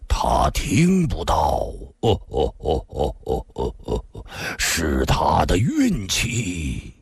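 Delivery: slow, low fading brute voice; grim menace. Acted. Yiku speaks to Lin Xian'er, slow and grim. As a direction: drag it out darkly.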